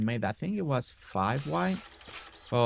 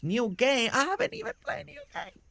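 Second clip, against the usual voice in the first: much higher squeaky mock voice, reading chat aloud; 13 semitones higher than usual. Streamer reads a viewer's teasing message in a high squeaky mock voice. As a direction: play the chatter in a squeaky put-on voice.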